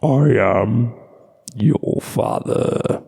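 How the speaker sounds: low, slow breathy villain rasp; menacing Sith lord intoning; Darth Vader voice. Acted. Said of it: "Darth [name]".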